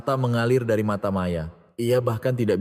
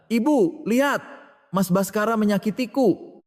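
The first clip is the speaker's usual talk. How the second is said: much higher, shrill-pitched cry of pain; 12 semitones higher than usual. A narrator's reading. Wife cries to mother-in-law as husband grips her wrist, high pained cry. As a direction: yelp it like someone gripping your wrist hard. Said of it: "Riana berteriak kesakitan".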